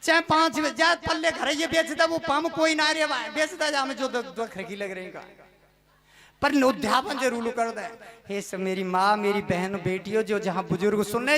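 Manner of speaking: ordinary delivery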